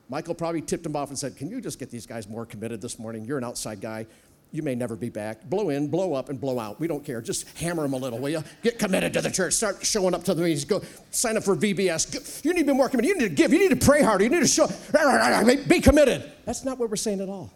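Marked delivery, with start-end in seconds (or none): 1.4-5.4 low, soft conspiratorial request; mock secret request, lower and softer; hushed aside. Imagined pastor's request. 12.1-16.3 fast, louder badgering sermon; hammed-up guilt-trip preaching, louder; mock haranguing.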